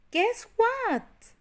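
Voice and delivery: put-on high eager child voice; high excited question. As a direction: tease the surprise like a thrilled girl. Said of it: "Bonnie looked excitedly".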